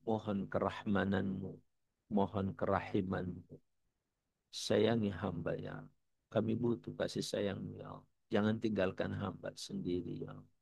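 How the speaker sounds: soft, humble supplication; demonstrating an inward prayer, gently; silent-heart prayer. Shown as a demonstration. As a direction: plead quietly with God. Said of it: "Minta ke Allah".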